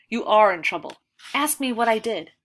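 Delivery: loud stern reprimand; stern rebuke. A narrator's reading. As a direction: slam it down as the headmaster. Headmaster scolding the boy.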